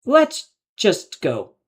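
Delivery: very slow, hesitant urging; slow unsure. A narrator's reading. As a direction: coax everyone to leave, slowly. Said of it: "he stammered".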